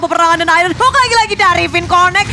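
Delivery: fast, high, hyped play-by-play; fighting game announcer. Real, not acted. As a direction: scream the big plays out fast. Climactic teamfight play-by-play.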